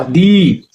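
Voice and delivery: दी held long as 'deee'; lengthened vowel. Shown as a demonstration. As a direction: stretch the ee in दी. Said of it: "थोड़ा लंबा". (दी को थोड़ा लंबा खींचकर)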